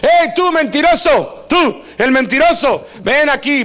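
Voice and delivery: put-on single out the kid in class; teacher calling out. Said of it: "te siguen llamando".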